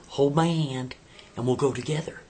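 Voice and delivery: coaxing. Acted. He coaxes her louder.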